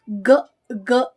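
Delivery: ordinary delivery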